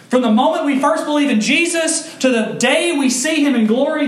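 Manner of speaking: high, loud climactic sermon delivery; fervent preaching. Acted. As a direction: preach it to the rafters. Preaching at full force.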